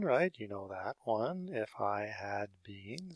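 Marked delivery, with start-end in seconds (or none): 1.5-3.2 if I had been spelled out slowly; slow, careful model phrase. Shown as a demonstration. Teacher repeating the phrase for students, slow and deliberate. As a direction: read "If I had been" slowly and clearly for the class. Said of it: "so the if I had been".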